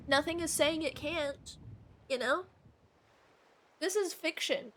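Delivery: usual speaking voice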